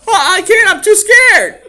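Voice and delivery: put-on high, loud whimpering kid voice; scared child. Boy stuck on ladder.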